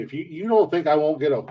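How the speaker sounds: normal talking voice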